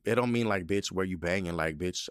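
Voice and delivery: ordinary talk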